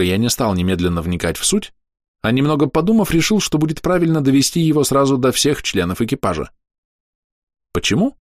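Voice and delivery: usual speaking voice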